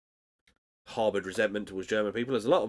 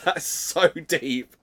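mock awe; 6 semitones higher, 4 dB louder, much slower than usual. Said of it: "Which I was like".

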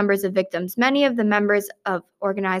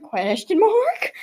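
slow, high questioning lilt; exaggerated puzzlement; 7 semitones higher, much slower than usual. Admitting confusion over her report, exaggerated puzzlement. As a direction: draw it out like a baffled question.

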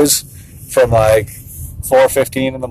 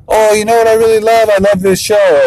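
put-on high-pitched, gushing little kid; six-year-old son; 8 semitones higher than usual. Six-year-old telling what show he loves, imitated high and keen.

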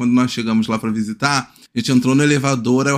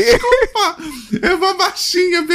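very high, squeaky laughing apology. Real, not acted. Apologising while correcting the granny's nickname, laughing. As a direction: squeeze the words out through laughter.

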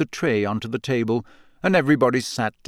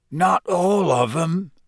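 hoarse, slow and higher-pitched; 6 semitones higher, slower than usual. A narrator's reading. Gamekeeper objecting to his headmaster, hoarse. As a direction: drag it out hoarsely. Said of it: "said Hagrid hoarsely".

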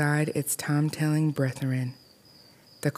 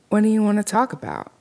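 higher, louder and fast, nervous; nervous blurt; 7 semitones higher, 4 dB louder, faster than usual. A narrator's reading. Frightened girl asks the dummy, fast and nervous. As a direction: rush it out, afraid.

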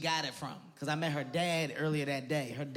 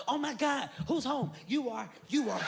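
high, gushing mock-happy girlfriend; happy woman greeting; 10 semitones higher than usual. Girlfriend greeting her man home, high and gushing. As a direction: squeal it like an overjoyed wife at the door. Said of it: "As soon as you come home".

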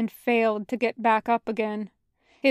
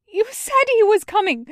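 high, cracking, desperate plea; voice breaking; 11 semitones higher, 6 dB louder than usual. Acted. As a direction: beg through a breaking voice. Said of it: "voice breaking".

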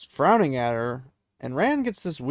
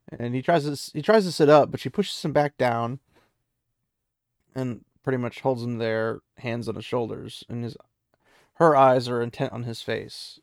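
ordinary delivery

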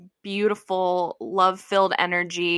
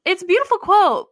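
mocking gushing; 11 semitones higher, 4 dB louder than usual. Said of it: "And then I, like".

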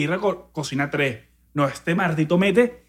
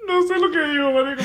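crying laughing; 6 semitones higher, 4 dB quieter than usual. Real, not acted. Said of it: "[name] está llorando".

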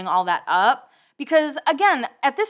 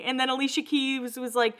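usual speaking voice

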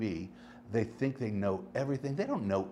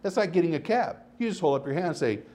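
usual speaking voice

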